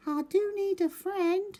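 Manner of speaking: very high small lonely voice; a shy little turtle owning up. Acted. Turtle telling the beavers it needs a friend, very high voice.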